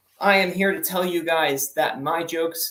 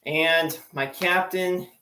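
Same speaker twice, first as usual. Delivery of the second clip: usual speaking voice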